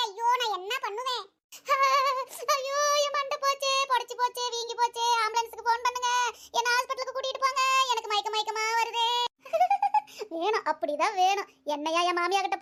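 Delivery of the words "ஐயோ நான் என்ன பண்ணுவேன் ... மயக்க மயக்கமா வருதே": high-pitched hammed-up lament, in a different voice; aunt hit by a coconut wailing at the daughter-in-law; mock wailing.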